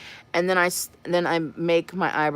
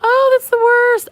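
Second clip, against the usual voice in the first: much higher, louder, slow drawn-out wail; exaggerated groan; 10 dB louder, slower than usual. Real, not acted. Telling viewers about plucked brows, a loud high wail. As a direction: wail it like it's a tragedy.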